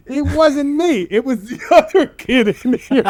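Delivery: half-laughing plea of innocence; laughing fake denial. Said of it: "I'm like".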